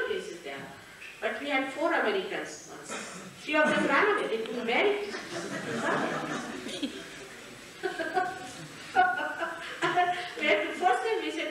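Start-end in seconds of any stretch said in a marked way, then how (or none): 5.2-10.3 long airy laugh at the memory; long amused laugh. Real, not acted. Laughing while telling of the runaways.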